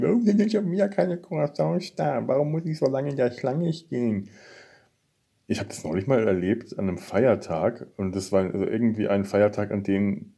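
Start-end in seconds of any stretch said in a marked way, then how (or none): 0.0-4.3 high, whining mock complaint; whiny complaining. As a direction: whine like a grumpy customer. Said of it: "sich beschweren".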